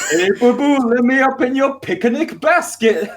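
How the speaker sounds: high, laughing cartoon bear voice; Yogi Bear voice. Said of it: "Yogi Bear".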